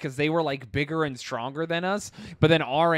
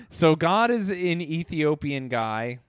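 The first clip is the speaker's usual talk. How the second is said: usual speaking voice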